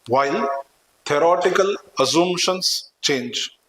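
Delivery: slow, word-by-word dictation pace. Acted. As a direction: spell it out slowly for note-taking. Dictating notes to students.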